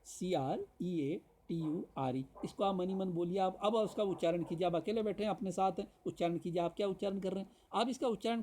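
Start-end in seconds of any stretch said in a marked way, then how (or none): 0.0-2.2 slow repeat of सीआरईएटीयूआरई, letter by letter; spelling repeated. Shown as a demonstration. Teacher repeats the spelling for students, slow letter by letter. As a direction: spell सीआरईएटीयूआरई out once more, slowly. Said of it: "spelling सुनिएगा".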